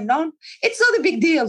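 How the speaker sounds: lightly acted "no big deal"; dismissive reassurance. Breezy retold reply to clients.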